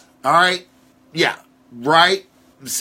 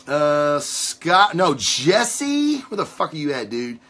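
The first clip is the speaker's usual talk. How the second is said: usual speaking voice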